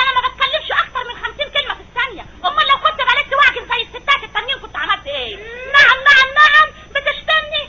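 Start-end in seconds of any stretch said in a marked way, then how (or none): 5.7-7.6 sharp, rising, slow indignant "نعم"; indignant wife. Acted. Wife taking offence at her husband, indignant and slow. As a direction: bristle with offence, dragging it out.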